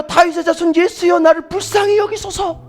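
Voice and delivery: high-pitched retold plea; imitated crying out. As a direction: call out like a blind beggar, volume easing off. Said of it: "막 소리를 질러요".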